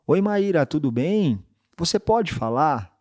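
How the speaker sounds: "Oi, Maíra" high and loud, then a slow "Você pode falar?"; bright phone greeting. Shown as a demonstration. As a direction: open cheerfully and high, then slow down on the question. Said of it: "vou falar Maíra, vai". ("Oi, Maíra" agudo e alto, e "Você pode falar?" devagar)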